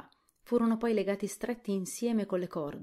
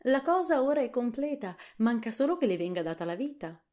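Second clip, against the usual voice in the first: high, louder, full of wonder; the bug admiring the flying thing, pitched up; 7 semitones higher, 6 dB louder than usual. A narrator's reading. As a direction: marvel at the finished machine. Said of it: "disse con grande ammirazione".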